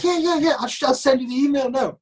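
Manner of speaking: high, eager reassurance; excited confirmation. Retold or imitated. Guy on the phone confirming the news, excited. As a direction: rush to confirm it in a high, thrilled voice.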